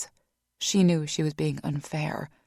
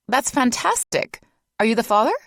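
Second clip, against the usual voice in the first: delighted nurse; 7 semitones higher, 6 dB louder than usual. A narrator's reading. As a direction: gush over him.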